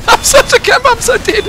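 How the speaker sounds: high, loud, squealed through laughter; laughing hard. Real, not acted. Gamer confessing to camping, laughing hard. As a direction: barely get it out for laughing.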